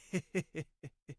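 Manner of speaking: villain's slow, menacing chuckle; sinister chuckle. Acted. Skullman approaching terrified Emmy, sinister chuckle. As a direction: chuckle to yourself at a helpless victim.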